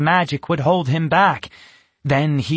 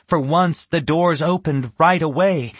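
ordinary delivery, nothing marked

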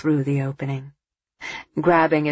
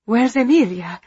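sudden alarm; 7 semitones higher, 4 dB louder than usual. A narrator's reading. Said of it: "Eva asked suddenly".

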